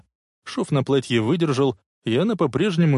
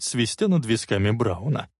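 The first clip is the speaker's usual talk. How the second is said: ordinary delivery, nothing marked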